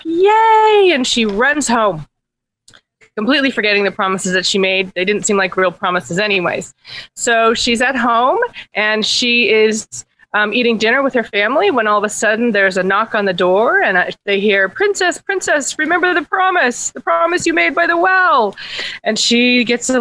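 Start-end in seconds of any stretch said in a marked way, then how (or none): 0.1-0.9 high, loud drawn-out "yay"; excited cheer. Acted. Princess gets her ball back. 14.7-18.6 high, singsong frog voice; frog calling out. Acted. Frog calls through the door.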